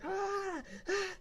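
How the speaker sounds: high-pitched demo scream, not full volume; mock scream. Shown as a demonstration. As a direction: let out a restrained mock shriek. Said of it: "I'll start screaming. I'll be like".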